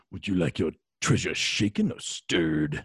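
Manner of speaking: slow Scottish Connery voice; lazy Scottish purr of Connery; Sean Connery impression. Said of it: "based the voice ... on Sean Connery".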